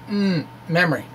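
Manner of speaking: normal talking voice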